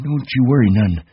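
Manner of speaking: put-on high-pitched old ranch hand; high voice, mildly comforting; reassuring Candy.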